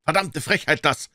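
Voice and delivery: high-pitched outrage from an onlooker; indignant. A narrator's reading. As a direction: sound scandalised at the nerve.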